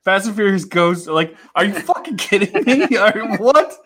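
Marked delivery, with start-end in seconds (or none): 1.5-3.7 high-pitched, laughing disbelief; incredulous outrage. Acted. Podcaster reacting to a film going to space, incredulous. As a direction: balk at it, voice jumping up through a laugh. Mock outrage at movie plot.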